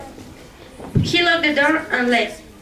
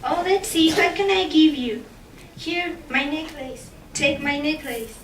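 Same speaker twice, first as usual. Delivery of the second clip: tentative offer, growing softer; hesitant girl; 2 semitones higher, 5 dB quieter than usual. Acted. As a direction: think aloud, then quietly hand it over. Girl offers her necklace.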